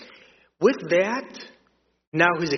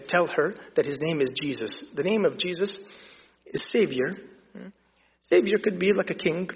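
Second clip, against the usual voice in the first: ordinary talk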